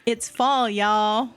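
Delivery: put-on a hopeful try at a Southern accent; a fair stab at a Southern accent; channeled Southern accent. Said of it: "I'm gonna try to channel one".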